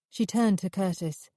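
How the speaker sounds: ordinary delivery, nothing marked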